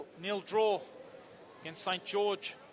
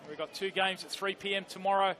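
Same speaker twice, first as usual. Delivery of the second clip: usual speaking voice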